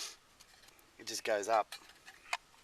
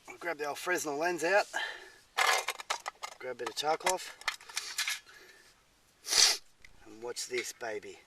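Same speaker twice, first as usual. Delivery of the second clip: stuffy, sniffly blocked nose; head cold; 2 semitones higher than usual. Real, not acted. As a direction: talk through a head cold. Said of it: "Sorry about the sniffling".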